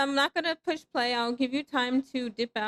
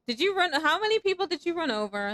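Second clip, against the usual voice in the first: ordinary talk, nothing marked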